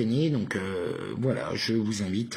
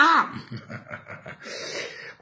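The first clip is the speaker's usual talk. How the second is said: high-pitched drawn-out laugh. Real, not acted. Laughing after own acted outburst, high.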